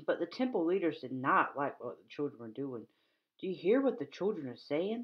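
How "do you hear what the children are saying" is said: ordinary talk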